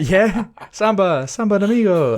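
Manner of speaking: slow, high hammed-up Mexican accent. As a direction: stretch Samba Domingo in a Mexican accent. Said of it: "Did you just try doing a Mexican accent?".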